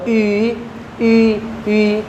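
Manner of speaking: ई held softly and carefully; teacher demo of the ई sound; vowel demo. Shown as a demonstration. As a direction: sound out ई slowly. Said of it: "जैसे इसकी sound के साथ मैं पढ़ रहा हूँ".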